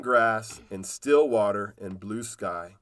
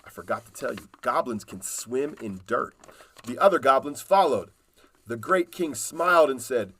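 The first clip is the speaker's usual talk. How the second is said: usual speaking voice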